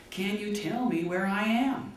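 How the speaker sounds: high, slow shouted question from the balloon; yelling down. Retold or imitated. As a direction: call down to a stranger far below. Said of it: "he yells down to him".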